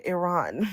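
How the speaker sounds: "Iran" repeated as ih-RAHN, broad A; echoed corrected pronunciation. Retold or imitated. Speaker conceding to the correction, "Iran" as ih-RAHN.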